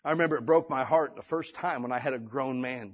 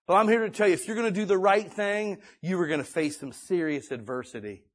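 preaching; 3 semitones higher than usual. Real, not acted. Preaching at full force.

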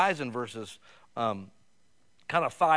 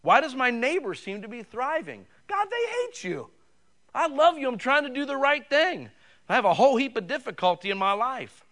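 much higher, pleading cry to God; aggrieved lament; 10 semitones higher, 6 dB louder than usual. Retold or imitated. David lamenting to God about his thriving neighbor, high and pleading. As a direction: plead like a wronged psalmist. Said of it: "David prayed those prayers".

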